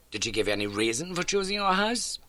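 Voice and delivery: a crisp, brisk detective; Holmes. A narrator's reading. Holmes introduces the landlady to Watson, brisk.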